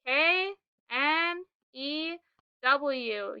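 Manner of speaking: "K N E W" said slowly, a letter at a time; spelled out slowly. Shown as a demonstration. Teacher spelling knew slowly for the class. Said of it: "We spell new".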